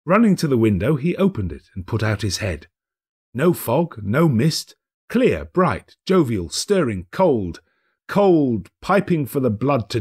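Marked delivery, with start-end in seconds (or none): none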